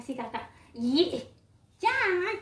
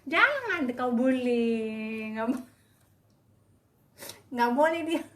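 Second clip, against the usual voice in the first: a drawn-out, stern telling-off; a pet owner slowly warns one cat off another; slow stern scolding. Real, not acted. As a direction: drag each word out in a slow, firm warning.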